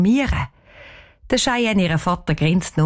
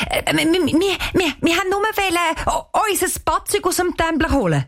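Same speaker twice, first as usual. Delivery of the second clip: high, nervous stammer; 11 semitones higher than usual. A narrator's reading. Girl caught by the caretaker making an excuse, stammering. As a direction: trip over the first words, pitched high. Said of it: "Danisa stottert".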